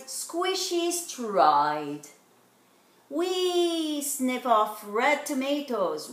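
usual speaking voice

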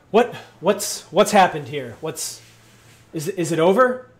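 loud, high, stammered questions; alarmed city guard. Acted. Head guard arriving at the aftermath, loud and alarmed.

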